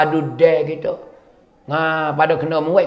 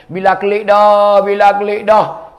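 slow, loud, raised announcement; acted excited calling out; 4 semitones higher, 4 dB louder, slower than usual. Townspeople see Bilal coming home, calling out excitedly. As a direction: spread the news with stretched-out calls.

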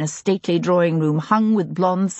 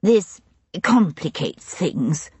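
plain reading voice

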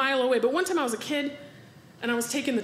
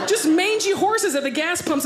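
ordinary talk, nothing marked

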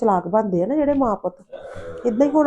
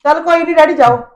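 loud pleading; 7 semitones higher, 6 dB louder than usual. Acted. Daughter calms angry father.

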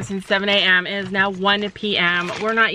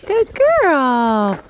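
praise; 4 semitones higher, 4 dB louder than usual. Real, not acted. Praising the horse.

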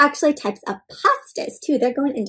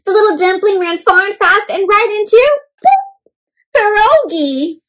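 excited buildup; 8 semitones higher, 5 dB louder than usual. A narrator's reading. Dumpling crashes into pierogi.

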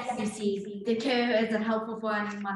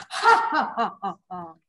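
villain laugh. Acted. Jezebel gloats over her plan with an evil laugh.

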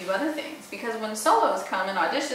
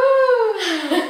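high whoop; 13 semitones higher, 9 dB louder than usual. Shown as a demonstration. Vocal demo sound.

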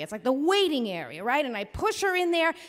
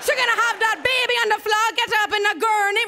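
put-on quick high island accent repeat; Caribbean accent, faster; 8 semitones higher, 5 dB louder, faster than usual. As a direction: rush the island-accent orders. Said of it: "here comes my accent".

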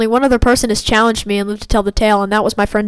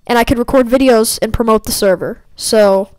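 ordinary talk, nothing marked

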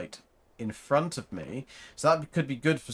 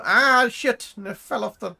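startled curse; 10 semitones higher, 8 dB louder than usual. Real, not acted. Falling off a cliff in game.

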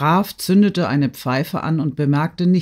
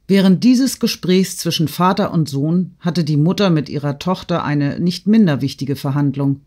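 usual speaking voice

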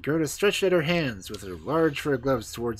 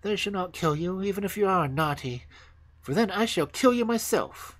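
put-on high-pitched, earnest girl; high, firm little robber; serious robber girl; 8 semitones higher than usual. A narrator's reading. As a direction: promise it solemnly in a high girl's voice. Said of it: "looked at her very seriously".

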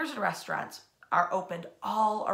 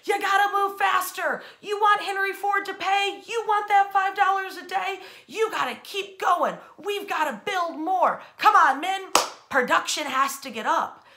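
loud, high barking push to work faster; foreman shouting; 8 semitones higher, 6 dB louder than usual. Retold or imitated. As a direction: yell at the line workers like a driving boss. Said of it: "bosses walking the line going".

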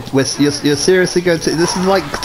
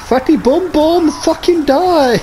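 high battle cry; 9 semitones higher than usual. Real, not acted. Attacking a boss.

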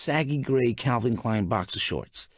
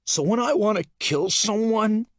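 unstable man; 6 semitones higher than usual. A narrator's reading. Narrator voices unstable Mystery.

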